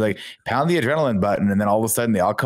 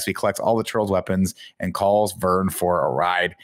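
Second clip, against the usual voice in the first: ordinary talk, nothing marked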